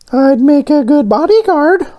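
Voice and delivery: squeaky high cartoon voice; high donut voice. A narrator's reading. Voices Arnie.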